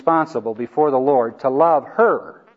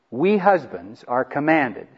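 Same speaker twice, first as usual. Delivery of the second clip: "commanded" said slowly and with weight; stress on commanded; slower than usual. Real, not acted. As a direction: lean hard on "commanded", drawn out. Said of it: "And I emphasize the word commanded".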